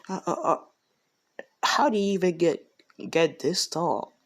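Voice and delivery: high, stammering astonished mother; acted amazement. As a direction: stumble into a high, incredulous question. Mother amazed at son's height.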